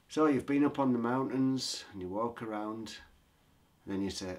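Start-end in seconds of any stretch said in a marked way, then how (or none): none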